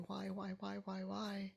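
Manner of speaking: normal talking voice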